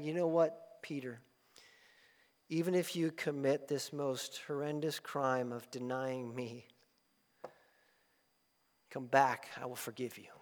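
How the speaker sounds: soft, gentle words of Jesus; hushed tender promise of forgiveness. Acted. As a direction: reassure Peter quietly. Voicing Jesus to Peter.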